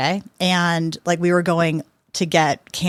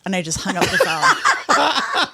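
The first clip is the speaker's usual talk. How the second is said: higher and slow, laughing through the words; 7 semitones higher, slower than usual. Real, not acted. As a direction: laugh it out as you confess. Laughing while recalling the blunder.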